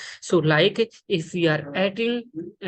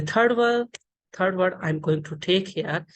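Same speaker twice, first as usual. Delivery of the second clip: ordinary talk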